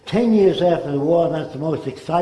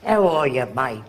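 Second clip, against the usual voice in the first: put-on Australian accent; 2 semitones lower than usual. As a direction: repeat the Aussie hello in an Australian accent, trailing off. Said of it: "you'd speak that way".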